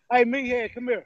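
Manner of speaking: loud call to the brother. Real, not acted. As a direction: call him over across the room. Calling brother over.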